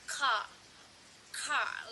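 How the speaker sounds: car with the R left silent; non-rhotic car, open vowel at the end; car without the R. Shown as a demonstration. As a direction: say car as "cah", dropping the final R. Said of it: "did not pronounce by them".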